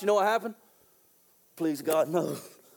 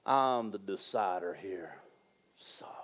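haughty king; 14 semitones lower, 10 dB quieter than usual. Retold or imitated. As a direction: whisper "Sovereign" smugly. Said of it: "what Nebuchadnezzar said".

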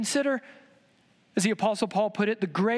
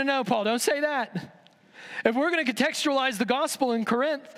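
higher, worried voice of a fan begging Paul; anxious pleading; 7 semitones higher than usual. Acted. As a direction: beg him to tone it down. Pro-Paul group pleads with Paul.

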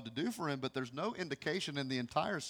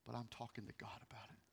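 soft reply; 7 semitones lower, 19 dB quieter, faster than usual. Retold or imitated. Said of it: "I said".

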